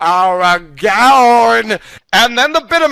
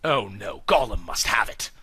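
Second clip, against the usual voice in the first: worried whisper; 8 semitones lower, 8 dB quieter than usual. Acted. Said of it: "Aragorn fears Gollum".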